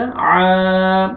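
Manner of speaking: आ held long from the throat, as Arabic ain. Shown as a demonstration. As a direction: stretch आ with an ain onset. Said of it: "आइन जबर अलिफ हो जाएगा". (आ को आइन से खींचकर)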